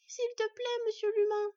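put-on high, loud little-girl begging; insistent fox-cub plea; persistent pleading. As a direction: keep begging in a child's high voice. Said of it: "persistèrent-elles en chœur".

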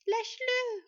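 put-on very high, loud child's plea; horrified cry. A narrator's reading. Sylvègue yelling at her biting sister, horrified cry. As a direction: shriek the order in horror. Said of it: "renchérit Sylvègue horrifiée".

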